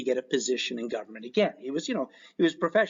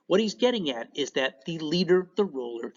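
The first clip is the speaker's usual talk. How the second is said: usual speaking voice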